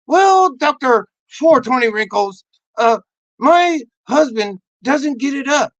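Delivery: high, loud wife voice; hammed-up spouse complaint to the doctor; complaining wife. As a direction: play the wife airing a grievance. Acted complaining wife in therapy.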